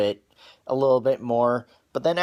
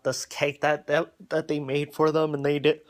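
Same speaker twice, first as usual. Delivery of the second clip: talking through a yawn. Real, not acted. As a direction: yawn while saying it. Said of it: "Sorry, I do tend to yawn sometimes".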